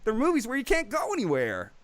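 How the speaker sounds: high, drawn-up mimicking voice; Matt Cragg impression. Host quoting a show regular on isolation movies, high-pitched impression. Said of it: "that was a good impression of him".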